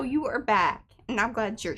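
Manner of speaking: ordinary talk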